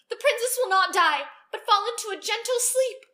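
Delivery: high, loud proclamation; good fairy crying out to save the princess. Acted. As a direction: cry it out to undo the curse. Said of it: "the good fairy cried".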